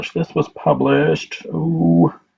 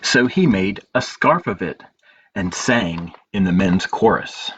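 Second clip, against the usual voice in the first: story narration; 3 semitones lower than usual. A narrator's reading. Librarian reading a picture book to children, gentle storytelling.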